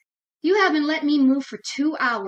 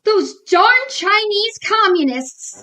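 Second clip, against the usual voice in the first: indignant; 6 semitones higher, 6 dB louder, slower than usual. Acted. Angry blame.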